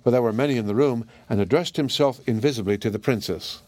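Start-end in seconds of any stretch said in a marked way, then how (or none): none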